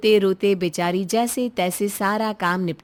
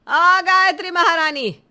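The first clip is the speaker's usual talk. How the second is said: mocking summons; 6 semitones higher, 4 dB louder than usual. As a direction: summon her with mock grandeur. Mother-in-law summons her mockingly. (ताना मारते हुए पुकारना)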